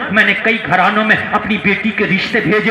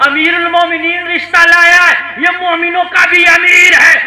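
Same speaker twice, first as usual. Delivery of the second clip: higher, louder rising preacher's shout; preaching at full force; 8 semitones higher, 7 dB louder than usual. Acted. Preacher tells the crowd Umar brought the proposal, shouting. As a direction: build the crowd to a peak. Climax of sermon.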